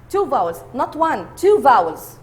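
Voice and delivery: loud, punched stress on "Two vowels"; emphatic correction. Shown as a demonstration. Teacher corrects one to two.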